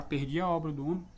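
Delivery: ordinary delivery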